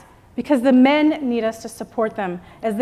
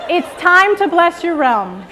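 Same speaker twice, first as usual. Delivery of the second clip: like you mean it; 6 semitones higher, 5 dB louder, slower than usual. Shown as a demonstration. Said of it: "Now say".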